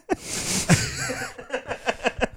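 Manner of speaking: a burst of high, squealing laughter; high shrieking laugh. Real, not acted. Big laugh.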